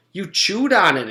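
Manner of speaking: loud, high accusing cry; exclamation. A narrator's reading. Rondi accusing Jason in front of class, loud exclamation. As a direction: shout the accusation. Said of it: "exclaimed Rondi".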